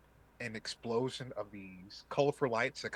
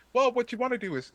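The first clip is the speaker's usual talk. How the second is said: loud high NPC voice; 12 semitones higher, 9 dB louder, faster than usual. Acted. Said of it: "He's like".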